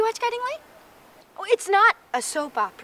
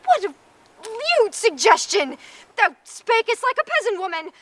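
high, loud theatrical indignation; hammed-up courtly outrage; scandalized outburst; 6 semitones higher, 5 dB louder than usual. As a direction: banish the offender like an affronted lady. Lady scandalized by crude remark.

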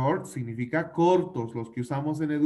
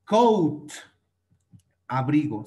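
ordinary talk, nothing marked

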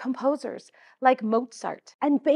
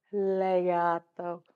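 smooth slow; 4 semitones lower, 6 dB quieter, slower than usual. Shown as a demonstration. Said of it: "play smooth on that one".

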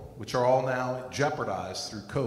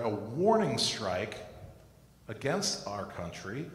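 ordinary talk

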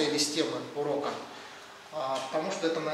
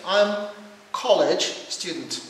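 slow British English, clearly stressed; British accent; 3 semitones higher, 5 dB louder, much slower than usual. Shown as a demonstration. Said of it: "this is American accent and British accent".

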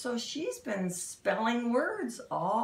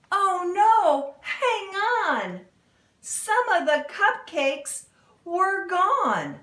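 high, loud storytime "oh no" cry; big theatrical panic, higher and louder; mock alarm; 10 semitones higher, 8 dB louder, slower than usual. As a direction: gasp it out in hammed-up dismay for the kids.

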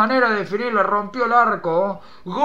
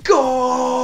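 loud, high goal cry; goal scream; 4 semitones higher, 5 dB louder than usual. Real, not acted. Fan celebrating Boca's goal, screaming. Boca scores.

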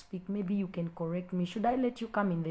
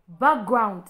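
normal talking voice

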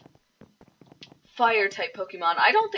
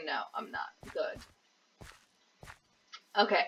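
ordinary talk

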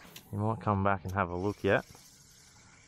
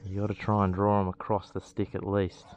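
ordinary talk

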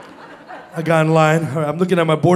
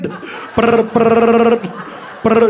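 phone ringing imitation; 4 semitones higher, much slower than usual. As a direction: roll out bursts like a ringing phone.

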